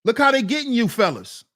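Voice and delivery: normal talking voice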